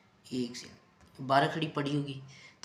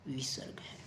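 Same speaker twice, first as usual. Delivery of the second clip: 1 whispered answer; 9 dB quieter than usual. Shown as a demonstration. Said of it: "ये क्या है?".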